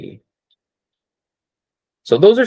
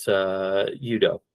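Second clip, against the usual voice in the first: ordinary talk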